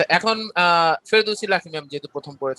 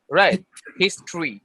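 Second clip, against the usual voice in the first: ordinary talk, nothing marked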